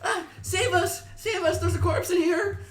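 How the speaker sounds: panicked cries. Acted. NPC women screaming for help.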